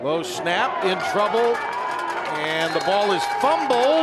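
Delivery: excited play-by-play. Real, not acted. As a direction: call the fumble as it happens. Live call of a fumble.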